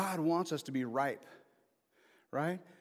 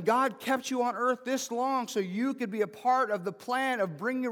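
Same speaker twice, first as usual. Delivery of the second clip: higher, louder preaching build; building sermon climax; 6 semitones higher, 5 dB louder than usual. Real, not acted. Pastor rallying the church to revival, rising preaching. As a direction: climb toward the big finish.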